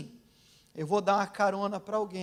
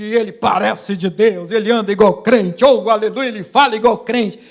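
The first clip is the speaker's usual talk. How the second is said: loud rapid-fire list, building in force; fervent, driving pulpit crescendo; impassioned preaching; 10 dB louder than usual. Real, not acted. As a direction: hammer each item out at full pulpit volume.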